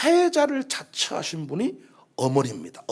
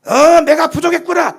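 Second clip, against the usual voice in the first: mock scolding; 8 semitones higher, 9 dB louder than usual. Imagined mother piling on the scolding.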